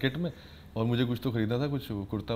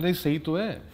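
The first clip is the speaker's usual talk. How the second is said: ordinary talk, nothing marked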